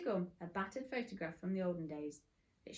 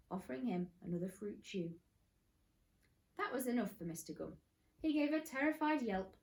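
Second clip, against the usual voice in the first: ordinary delivery, nothing marked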